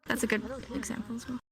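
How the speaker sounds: soft and hurried; quiet. Real, not acted. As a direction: murmur it quickly. Soft quick reply.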